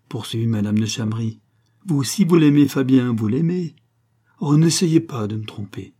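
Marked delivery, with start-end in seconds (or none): 1.9-5.9 higher, forceful insistence; insistent appeal. A narrator's reading. Mother confronting the man her daughter loves, insistent. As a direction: press him hard for the truth.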